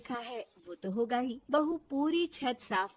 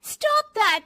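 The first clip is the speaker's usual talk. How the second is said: loud, high snapped command; angry shout; 12 semitones higher, 10 dB louder than usual. Acted. Daughter-in-law finally snaps at mother-in-law, angry shout. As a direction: blurt it out in anger. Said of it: "जोर से गुस्से से बोल देती".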